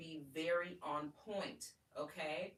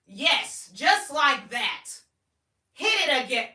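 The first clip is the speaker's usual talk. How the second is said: high, loud, harsh mock commands; mock loud bossy tone; 8 semitones higher, 19 dB louder than usual. Shown as a demonstration. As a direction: ham up an unsexy bossy voice. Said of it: "Who wants that?".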